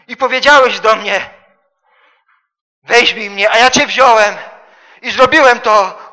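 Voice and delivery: loud, high, fervent preaching; preaching at full force. Acted. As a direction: proclaim it to the whole church. Preacher voices Jesus, then exhorts.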